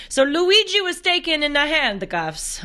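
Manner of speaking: high, hammed-up Italian lilt; mock Italian accent. Host reporting an arrest, slipping into Italian accent. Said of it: "talk in my Italian accent".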